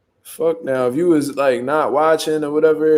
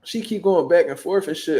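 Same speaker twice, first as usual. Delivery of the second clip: usual speaking voice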